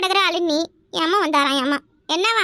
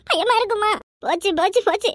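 ordinary delivery

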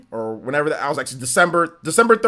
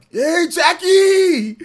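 high, slow drawn-out yell across the arena; crowd shout; 12 semitones higher, 5 dB louder, much slower than usual. Retold or imitated. Rowdy fan calling to a model in the skybox, high yell. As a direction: call out to someone far off in the stands. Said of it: "somebody said, like".

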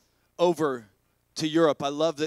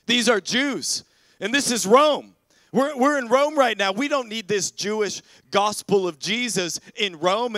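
acted indignation building to loud, higher protest; mock outrage, voice raised; riled-up crowd; 5 semitones higher, 5 dB louder than usual. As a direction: play an agitator stirring up the crowd. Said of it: "they get everybody riled up".

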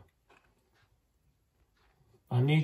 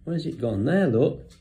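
usual speaking voice

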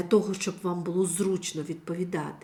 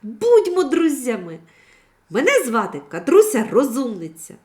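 put-on higher, louder eager girl voice; 6 semitones higher, 9 dB louder than usual. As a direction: offer friendship brightly. Дівчинка радісно пропонує дружбу. (захоплено)

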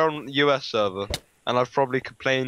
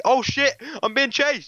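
high, panicked cry for help; sudden high-pitched alarm; alarmed yell; 13 semitones higher, 3 dB louder than usual. Real, not acted. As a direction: yell it as you flee. Player suddenly chased in game.

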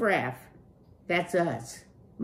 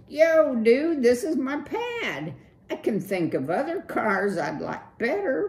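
higher, loose slacker-dude voice; Clutch, cool dude; 7 semitones higher, 6 dB louder than usual. Acted. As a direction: play the laid-back streetwise rocker.

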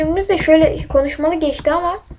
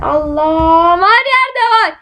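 panicked gamer yelp; alarmed cry; much slower than usual. Real, not acted. Alarmed by enemies everywhere.

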